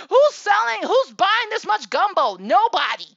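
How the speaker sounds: loud, high disbelieving rant; almost yelling in disbelief at the whole idea; exasperated outburst. Real, not acted. Exasperated rant about gumbo fundraiser.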